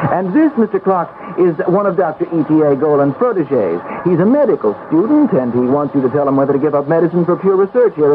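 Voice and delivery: a fussy assistant ushering people to the great man; eager clerk voice. Acted. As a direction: chirp out the introductions like a busy little clerk. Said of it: "will be his clerk".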